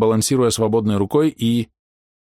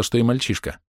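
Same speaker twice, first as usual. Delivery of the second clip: plain reading voice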